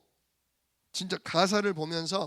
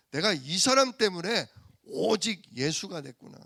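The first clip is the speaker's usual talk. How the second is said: louder, fervent realisation; emphatic realisation; 4 dB louder than usual. Acted. Believer realising this person led them to Jesus, emphatic. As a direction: declare the discovery with force. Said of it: "그게 발견되면요".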